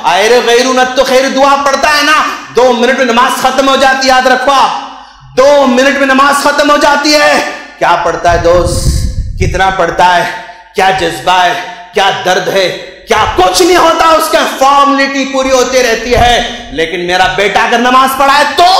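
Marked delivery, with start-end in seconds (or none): 0.0-7.4 impassioned preaching. Acted. Preaches at full force. 13.1-18.7 impassioned preaching. Acted. Preaches at full force.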